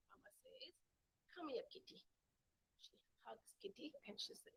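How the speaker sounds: soft whisper; hushed narration. Acted. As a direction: whisper it tenderly. Mother hugs kitten.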